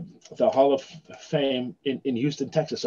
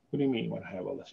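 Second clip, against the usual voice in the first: rushed, hushed disbelief; bewildered, quick and soft; 4 semitones lower, 11 dB quieter, faster than usual. Retold or imitated. Son asking the archivist, quick hushed disbelief. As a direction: tumble it out under the breath in shock. Speaker's retold bewildered question.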